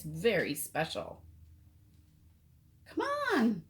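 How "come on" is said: put-on high, eager little-girl call; eager child. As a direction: pull daddy along, impatient and high. Eager child urging daddy.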